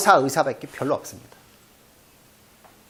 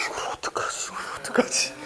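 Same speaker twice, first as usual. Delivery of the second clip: unvoiced worried whisper; 9 dB quieter than usual. Torn over the cat's pheasant, whispering.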